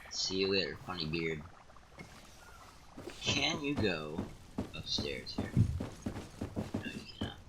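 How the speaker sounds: usual speaking voice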